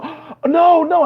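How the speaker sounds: mock shocked protest. Coworkers react to race joke.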